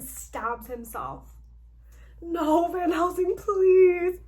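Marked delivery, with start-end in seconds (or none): 2.2-4.2 high, loud tearful cry; crying plea. Acted. Heroine pleading as he stabs himself, crying. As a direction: beg through tears, voice cracking. Heroine begs dying Van Helsing.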